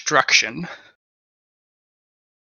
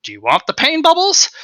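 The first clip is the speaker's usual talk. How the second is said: a lightly voiced, much higher tempting question; offering the pain bubbles; 13 semitones higher, 4 dB louder than usual. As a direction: offer the bubbles like a sly spirit, rising at the end.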